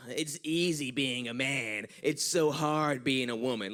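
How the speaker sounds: slow put-on lament; imitating a long-suffering feminist; mock feminist. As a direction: complain in a drawn-out mocking voice. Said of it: "one of these new age feminists".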